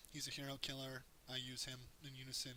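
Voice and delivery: ordinary delivery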